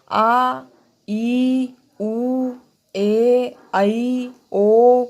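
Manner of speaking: आ, ई, उ, ए, ऐ, ओ each held long and loud; long vowels drawn out for students. Shown as a demonstration. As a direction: stretch every vowel out. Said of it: "ह्रस्व से दुगुना समय लगता है".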